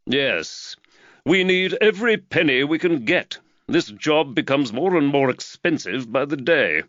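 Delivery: a hint of a harried employer fretting over costs; Reed the magistrate. A narrator's reading. Reed voiced by narrator.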